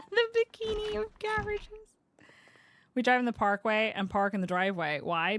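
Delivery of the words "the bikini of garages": high squeal of laughter through the words; helpless laughing. Real, not acted. Streamer tickled by own carport comparison, laughing helplessly. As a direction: squeak it out while cracking up.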